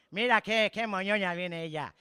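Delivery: ordinary delivery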